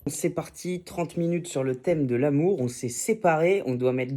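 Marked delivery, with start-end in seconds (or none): none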